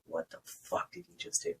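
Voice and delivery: hushed, voiceless imitation of shocked onlookers; mock shocked. Imitating everyone reacting to the red hair, mock shocked. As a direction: drop to an airy, appalled half-whisper.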